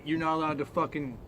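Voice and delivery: usual speaking voice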